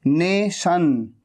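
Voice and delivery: नेशन drawn out slowly with a full sh; very slow नेशन, sh clearly heard; slow nation demo. Shown as a demonstration. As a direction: stretch नेशन, stressing the sh.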